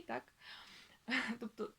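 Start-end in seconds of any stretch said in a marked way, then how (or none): none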